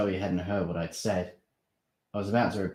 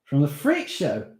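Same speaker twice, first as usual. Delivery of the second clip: surprised; 8 semitones higher, 6 dB louder than usual. Acted. Steve's surprised question.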